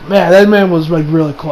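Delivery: usual speaking voice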